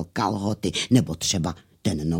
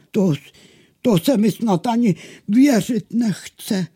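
high, halting disbelief; disbelieving; 10 semitones higher, 4 dB louder than usual. Acted. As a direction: stumble into it, hardly believing. Water sprite doubts the miller.

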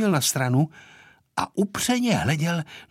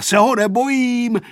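put-on high, loud boyish yell; defiant shout; 8 semitones higher, 5 dB louder than usual. A narrator's reading. Vojta to the pilot about the caretaker, defiant shout. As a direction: shout it out boldly, pitched up. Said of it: "křičel Vojta do pilotova ucha".

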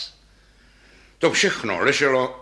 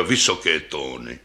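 put-on deep old bass voice; 8 semitones lower than usual. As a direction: sigh it out deep like an aged instrument. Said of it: "pravila hlubokým hlasem basa". (hluboký hlas staré basy)

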